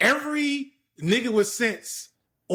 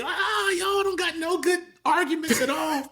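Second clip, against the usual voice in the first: high, drawn-out mock complaint; mocking wail; 8 semitones higher, slower than usual. Baiting the divestors with their weak arguments, mocking wail. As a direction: stretch it out high, making fun of them.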